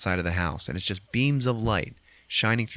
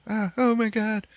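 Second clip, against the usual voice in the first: high frightened cry; 14 semitones higher than usual. Retold or imitated. Lisa crying in the corner, high frightened cry. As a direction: gasp out in fear. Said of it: "And then she's like".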